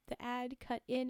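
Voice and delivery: ordinary delivery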